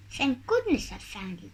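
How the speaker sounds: ordinary delivery, nothing marked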